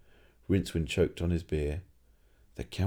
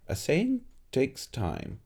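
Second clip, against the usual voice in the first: peevish; 6 semitones higher, much slower than usual. Acted. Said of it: "said the old alchemist peevishly".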